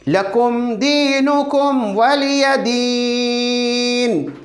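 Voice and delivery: slow, drawn-out chanted recitation; slow Quran recitation. Shown as a demonstration. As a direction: intone each word slowly for students. Teacher recites surah slowly. (बच्चों को सिखाते हुए धीमी तिलावत)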